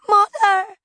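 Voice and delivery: put-on high, loud child's scream; scream for help. A narrator's reading. Little girl outside among burning tents, screaming for help. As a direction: scream out for rescue. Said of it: "she screamed for help".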